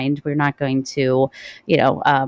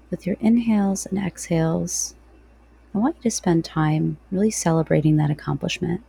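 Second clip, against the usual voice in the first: guided meditation. Real, not acted.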